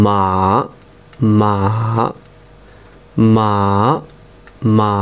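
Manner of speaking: মা dipping down then back up; third tone like a wave. Shown as a demonstration. Teacher demonstrating Mandarin third tone on মা. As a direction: let মা fall and rise like a wave. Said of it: "ঢেউয়ের মতো".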